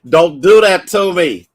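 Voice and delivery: tearful loud plea; fighting back tears. Real, not acted. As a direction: beg them to stop, choking up. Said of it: "'cause I'm trying not to cry".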